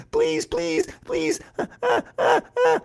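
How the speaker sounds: pleading that climbs into high squeals; beg, then dissolve into panicked shrieks; terrified mouse. A narrator's reading. Mouse cornered by Cat's teeth, squealing terrified pleas.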